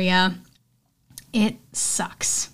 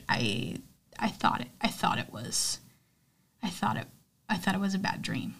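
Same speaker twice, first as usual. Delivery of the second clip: hushed, choked-up retelling; 9 dB quieter than usual. Retold or imitated. Retelling telling friends aunt's death.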